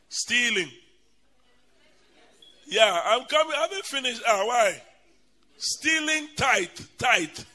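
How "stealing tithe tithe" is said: Tithe hammered loud and slow; stress on tithe. Acted. Warning the congregation, Tithe shouted with slow stress. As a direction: hit the word Tithe hard.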